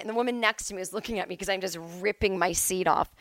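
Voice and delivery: usual speaking voice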